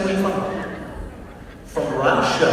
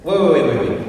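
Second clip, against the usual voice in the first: ordinary delivery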